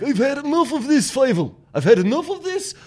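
high, loud cartoon father mouse; fed-up papa mouse declaring the move; dad mouse rallying the family, high and loud. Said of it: "And the dad's like".

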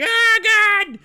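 very high, loud terrified scream. Retold or imitated. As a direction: shriek it in horror. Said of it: "they're like".

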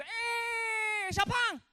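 high, loud, long drawn-out hollered greeting; barber's greeting. Retold or imitated. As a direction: holler it like the barber hailing a regular. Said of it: "every time he'd be".

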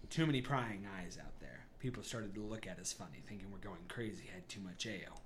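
low, soft, guarded explanation; confiding Garrick. Acted. Garrick to the party behind closed door, low and confiding. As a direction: keep it down, away from prying eyes. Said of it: "He goes".

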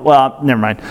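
ordinary talk, nothing marked

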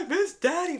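high mock wail; hammed-up weepy cry, pitched high; imitated crying. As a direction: mimic a drunk sister's tearful whine. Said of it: "starts fucking crying 'cause my dad died".

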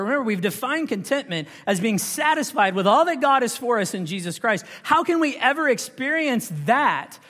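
high, loud, driving rhetorical questions; full-force preaching. Real, not acted. Preacher pressing the congregation on trusting God, impassioned and loud.

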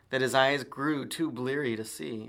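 plain reading voice

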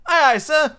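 high, loud eager echo; obedient recruit. A narrator's reading. John obediently repeats the reply.

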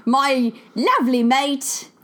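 put-on light, slow British accent on lovely mate. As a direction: introduce your co-host like a London presenter. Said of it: "my very famous British accent indeed".

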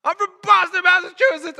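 hoarse-effort athlete's bellow; winded yell. Acted. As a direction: yell it out like an exhausted player. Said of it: "he yells his name".

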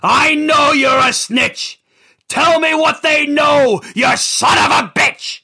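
loud, high shouted threat at a snitch; screaming threat. Acted. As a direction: yell it in his face. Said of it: "Big Richie screamed".

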